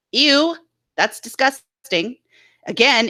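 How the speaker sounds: usual speaking voice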